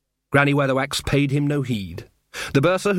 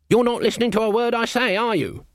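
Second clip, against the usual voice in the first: a hint of higher, stern old witch; exasperated Granny; 10 semitones higher than usual. Granny Weatherwax chiding a dreamy Nanny Ogg, exasperated. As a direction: snap at a daydreaming friend, lightly.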